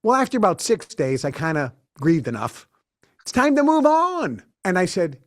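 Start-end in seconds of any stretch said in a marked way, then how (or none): 3.2-4.4 high, louder upbeat declaration; buoyant, lifted finish; bright resolve. Real, not acted. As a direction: snap out of it cheerfully. Decides to stop grieving.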